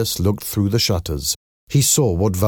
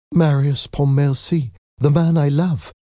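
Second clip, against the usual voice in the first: lightly voiced daughter, higher-pitched; Cosette's voice; 8 semitones higher than usual. A narrator's reading. Daughter confessing her love to papa, higher-pitched. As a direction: declare it in a raised pitch.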